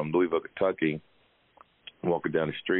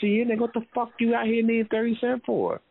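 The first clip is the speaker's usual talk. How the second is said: mock scolding. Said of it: "I said".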